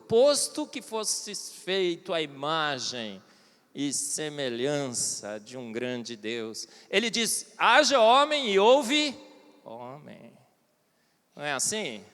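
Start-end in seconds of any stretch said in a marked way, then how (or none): 0.1-6.7 low, very slow and grand, like scripture; solemn proclamation. Pretend. Solemn biblical phrase in quote. 7.6-10.3 grand declaration. Retold or imitated.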